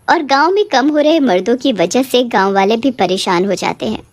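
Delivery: usual speaking voice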